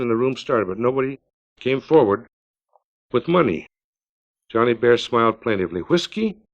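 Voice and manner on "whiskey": high, rising bird-like call; high bird-call plea. Acted. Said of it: "It was like a bird call".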